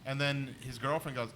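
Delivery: ordinary talk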